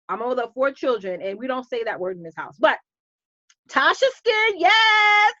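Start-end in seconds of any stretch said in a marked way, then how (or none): 3.7-5.4 high, loud laughing cheer; delighted shout and laugh; excited cheer. Real, not acted. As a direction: whoop and laugh with joy. Excited reaction to a comment.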